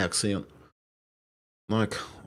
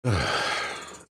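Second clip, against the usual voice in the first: long breathy sigh; 2 semitones lower than usual. Real, not acted. Streamer pausing before carrying on, long sigh. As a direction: let out a long, trailing sigh.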